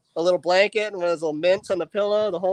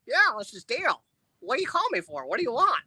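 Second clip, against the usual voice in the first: a higher put-on voice pretending to be Dale; faked Dale voice; 6 semitones higher than usual. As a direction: play a fake Dale, gruff and annoyed. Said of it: "put myself back on".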